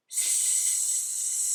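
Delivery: long unvoiced hiss; drawn-out s like a snake. Shown as a demonstration. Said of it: "Can we do that sound together?".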